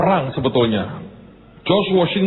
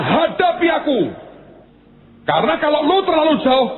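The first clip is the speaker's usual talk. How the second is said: "Hadapi aku" shouted high and hard; taunting shout across the field; giant's shout; 7 semitones higher than usual. Acted. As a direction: bellow the challenge like Goliath. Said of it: "Dan dia teriaknya".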